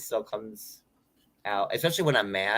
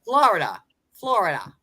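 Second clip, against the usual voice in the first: put-on Florida said the old Long Island way, higher; old Long Island accent; 4 semitones higher, 3 dB louder than usual. Shown as a demonstration. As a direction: demo the old pronunciation. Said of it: "but I used to say".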